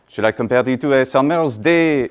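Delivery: exaggerated French accent, flat stress, mangled r's; caricature French accent. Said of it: "caricature of an accent".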